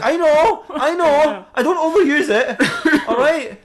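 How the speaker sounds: high, squealed admission; hammed-up squirming excuse; defensive guilt. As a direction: protest guiltily with pitch shooting up. Batman guiltily defends himself.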